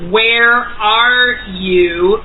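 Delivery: very slow, drawn-out summons; telepathic call. Retold or imitated. Woman silently calling her contact with her mind, drawn out. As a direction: stretch out each word like a mental call. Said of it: "I'm saying telepathically".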